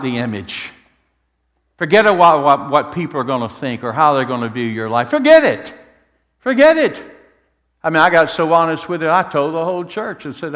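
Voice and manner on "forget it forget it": high, loud, punched command; forceful exhortation. Real, not acted. Preacher urging the congregation to drop their image, loud and high. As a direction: fling it away with full force.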